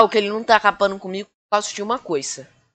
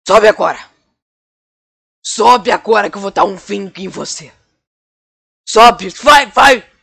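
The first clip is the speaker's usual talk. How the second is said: angry shouting; 6 dB louder than usual. Acted. Villain orders at gunpoint.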